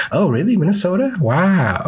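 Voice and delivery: imitating people's wide-eyed surprise; amazed mishearing. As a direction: play the impressed stranger who misheard. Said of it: "People are like".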